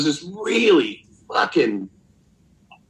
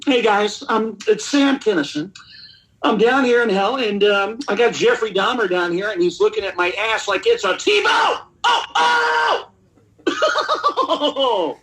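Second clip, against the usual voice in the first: shrieking Kinison-style comic bit with a wild scream at the end; Sam Kinison impression; 8 semitones higher than usual. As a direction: hammer it like a raging stand-up yelling from hell. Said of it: "as Sam Kinison calling live from the gates of hell".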